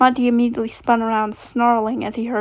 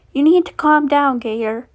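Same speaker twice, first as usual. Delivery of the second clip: loud, raised order; firm command; 4 semitones higher, 5 dB louder than usual. A narrator's reading. Roxanne pinning down thrashing Monty, firm loud command. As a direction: order it forcefully.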